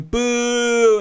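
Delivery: crowd booing. Retold or imitated. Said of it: "everyone's going".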